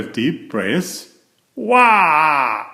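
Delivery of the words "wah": put-on a loud, high baby's wah; baby sister bursting into a loud wail. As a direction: wail like a newborn waking up. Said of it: "she wailed".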